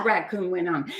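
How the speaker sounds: plain reading voice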